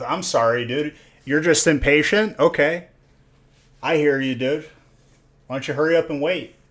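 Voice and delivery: play-scold an impatient viewer, pushing the voice up; mock impatient reply. Streamer jokingly replying to an impatient viewer, mock exasperated. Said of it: "I'm just kidding".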